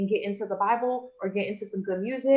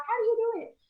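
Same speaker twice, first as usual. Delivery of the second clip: pitched way up, wide-eyed question; mock-awed question; high asking voice; 10 semitones higher, faster than usual. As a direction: ask it high like an eager fan. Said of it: "Here's why you ask".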